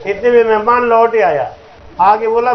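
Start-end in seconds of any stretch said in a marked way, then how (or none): none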